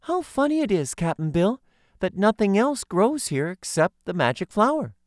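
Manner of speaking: put-on high, wondering girlish voice; young girl voice. A narrator's reading. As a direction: exclaim it like a delighted little girl. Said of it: "the girl exclaimed".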